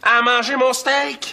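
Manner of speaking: high, drawn-out indignant cry; outraged. Acted. Man finding his steak eaten by the sitter, outraged. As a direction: wail it in outrage, stretching the words.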